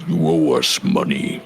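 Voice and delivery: deep gravelly orc voice; low, rough growl of a debt collector. Acted. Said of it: "in a kind of deep, gravelly voice says".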